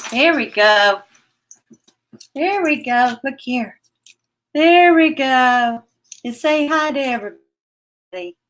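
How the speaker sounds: high cooing praise; doting pet praise. Real, not acted. Owner praising her pet for coming up, high cooing. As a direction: coo over the pet as it settles.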